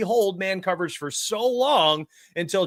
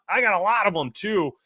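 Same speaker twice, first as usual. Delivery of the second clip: usual speaking voice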